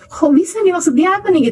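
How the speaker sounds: high-pitched, wondering question; retold puzzlement. Recalling her reaction to the wife's answer, puzzled. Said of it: "Heran juga saya waktu denger itu".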